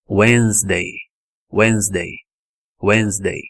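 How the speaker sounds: "Wednesday" said WENZ-day without the d; correct English "Wednesday". Shown as a demonstration. Teacher modelling "Wednesday" with a silent d. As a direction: skip the d in "Wednesday". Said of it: "O D não existe nessa palavra".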